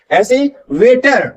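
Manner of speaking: ordinary talk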